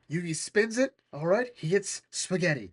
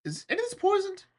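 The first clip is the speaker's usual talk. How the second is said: very high, quick squeaky question; high-pitched alarmed query; worried Joey asking; faster than usual. Acted. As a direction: squeak it out nervously. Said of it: "trying to ask the question".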